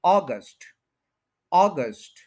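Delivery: August said as English AW-gust, falling; full weight on "AW" in August, light "gust"; first-syllable stress. Shown as a demonstration. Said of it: "अ को हम पूरा stress देंगे". (अगस्त को "ऑ-गस्ट" बोलें, "ऑ" पर ज़ोर)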